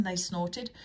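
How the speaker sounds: plain reading voice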